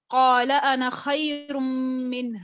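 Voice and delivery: slow continuous recitation of قال انا خیر منہ, the alif of انا not lengthened; slow Quran recitation. Shown as a demonstration. Teacher reciting Iblis's words قال انا خیر منہ in continuation, slow. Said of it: "continuation میں پڑھوں گی تو میں اس کو کیسے پڑھوں گی؟".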